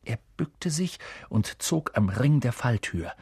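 ordinary delivery, nothing marked

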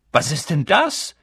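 put-on astonished boy calling out; sudden exclamation. A narrator's reading. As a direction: blurt it out loudly. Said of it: "rief einer der Zwillinge plötzlich".

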